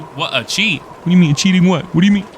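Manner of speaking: loud, high, outraged what; outraged shouting. Real, not acted. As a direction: yell in disbelief at the cheat. Outraged reaction to cheat code.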